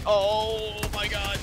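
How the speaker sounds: slow, high, drawn-out relief; relieved gasp. Real, not acted. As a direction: let the relief stretch out. Relief after boss killed.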